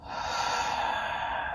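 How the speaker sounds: long, soft sigh out; demonstrated exhale. Shown as a demonstration. Yoga teacher breathing out tightness for the class, long sighing exhale. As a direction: let out a slow, airy sigh. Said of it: "trying to breathe out that tightness".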